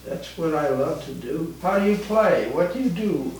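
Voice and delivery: ordinary talk, nothing marked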